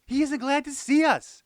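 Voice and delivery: high, loud, hurt wail. A narrator's reading. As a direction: cry out that the dog has turned away. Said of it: "I wailed".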